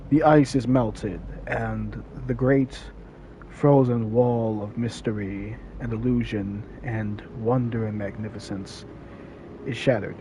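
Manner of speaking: measured, philosophical narration; solemn monologue. Acted. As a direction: muse aloud like a film prologue. Opening philosophical monologue over music.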